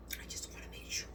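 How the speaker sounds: breathy whispered aside to herself; voiceless murmur while going in for another bite. Real, not acted. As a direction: whisper it as a private note. Second taste, murmured aside.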